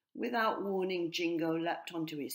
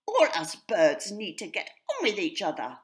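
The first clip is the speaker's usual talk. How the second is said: chirpy sparrow; 4 semitones higher, 10 dB louder than usual. A narrator's reading. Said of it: "Sue the sparrow chirped".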